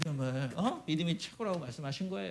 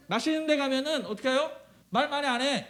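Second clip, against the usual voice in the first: normal talking voice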